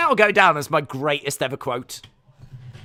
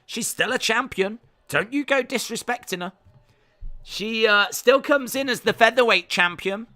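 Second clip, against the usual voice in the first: raised, projected ringside commentary; walkout announcer; 5 semitones higher, 4 dB louder than usual. Acted. Streamer calling Amanda Nunes's walkout, announcer style. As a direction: announce the champion's walk to the cage.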